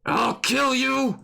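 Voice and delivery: slow, high, strained threat; held-back furious yell. Acted. Alice threatening the liar, held-back yell. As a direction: draw out a threat without truly screaming. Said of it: "Well, I, I already screamed".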